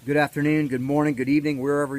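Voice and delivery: energetic greeting. Real, not acted. Energetic show opening greeting.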